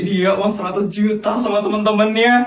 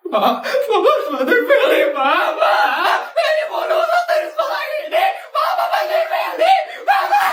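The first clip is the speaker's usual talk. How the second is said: desperate pleading scream. Acted. Feli begs her father to help her graduate, desperate screaming.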